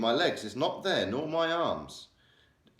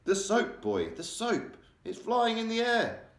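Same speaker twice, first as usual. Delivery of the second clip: astonished father; 12 semitones higher, slower than usual. Acted. Father astonished at floating soap.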